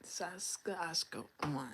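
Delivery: "Saskatchewan" whispered slowly as "Saska-asko-wan"; stumbling attempt. Real, not acted. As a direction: trip softly over "Saskatchewan". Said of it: "Dang, that's a tongue twister".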